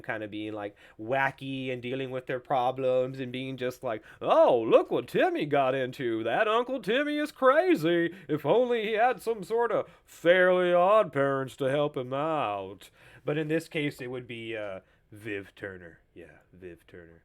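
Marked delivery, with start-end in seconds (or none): 4.2-12.9 hammed-up cartoon reaction. Host spoofing a wacky show's characters, hammed-up cartoon reaction. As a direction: play it broad and wacky, sing-song.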